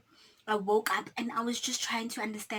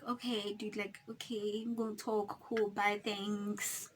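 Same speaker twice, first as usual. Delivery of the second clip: much softer, mumbled awkward goodbye; awkward brush-off; 2 semitones lower, 10 dB quieter than usual. Retold or imitated. Boyfriend awkward on phone.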